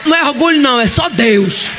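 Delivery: full-force revival preaching; fiery preaching. Acted. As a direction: preach it out to the crowd.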